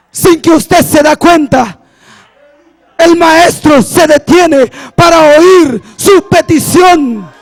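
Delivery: emphatic pulpit exhortation; rousing preacher delivery with pauses; fervent preaching. Real, not acted. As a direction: press each phrase on the congregation. Preaches to congregation.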